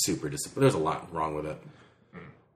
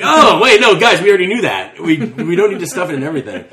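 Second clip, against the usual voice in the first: excited exclamation; 5 semitones higher, 9 dB louder than usual. Retold or imitated. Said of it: "Be like".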